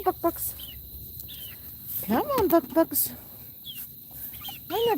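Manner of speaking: high clucking chicken call. Real, not acted. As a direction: coax the hens over, clucking.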